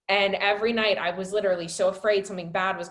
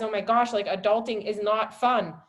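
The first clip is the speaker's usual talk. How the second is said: ordinary talk, nothing marked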